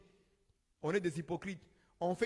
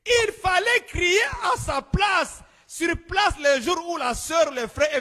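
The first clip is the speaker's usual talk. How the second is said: higher, louder, slow pulpit shout; preaching shout; 7 semitones higher, 5 dB louder than usual. Acted. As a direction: hammer the rebuke at the pastor. Preaching at full force.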